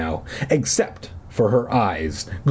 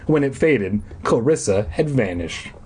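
ordinary delivery, nothing marked

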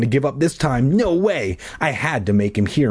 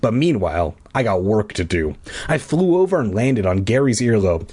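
plain reading voice

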